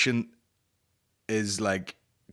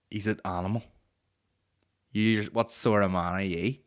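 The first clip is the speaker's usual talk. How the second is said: ordinary talk, nothing marked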